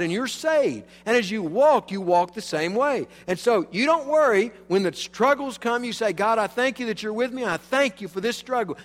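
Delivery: rising pulpit fervor; fervent preaching. Acted. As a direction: drive it home to the congregation. Sermon builds to fervor.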